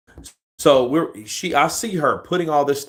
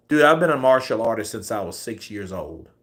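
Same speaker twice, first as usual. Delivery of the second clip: normal talking voice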